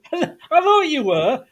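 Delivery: laughing through the words, pitched high; real laugh breaking into the line; laughing it off so as not to cry. Real, not acted. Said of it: "I'm laughing because if I don't".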